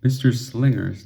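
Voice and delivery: ordinary delivery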